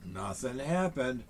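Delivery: usual speaking voice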